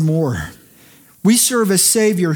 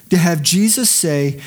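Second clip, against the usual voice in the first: normal talking voice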